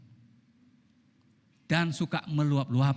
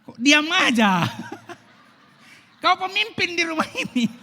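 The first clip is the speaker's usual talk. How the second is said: much higher, shouted nag through laughter; hammed-up nagging wife; 13 semitones higher, 9 dB louder than usual. Preacher replays the wife's nagging as a laughing mock shout. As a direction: overplay the shrill wife while cracking up.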